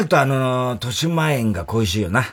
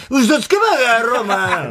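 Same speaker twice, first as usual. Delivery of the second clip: mock outburst; 10 semitones higher, slower than usual. Real, not acted. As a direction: jeer at her like a heckler. Mocking a newscaster's pompous claim.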